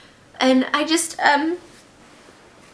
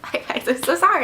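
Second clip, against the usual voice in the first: ordinary talk, nothing marked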